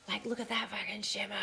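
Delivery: hushed whisper, breathless with awe; awed whisper. Real, not acted. To her viewers, admiring the shimmery fabric, awed whisper. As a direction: whisper it like a secret treasure.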